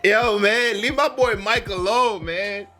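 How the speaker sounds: normal talking voice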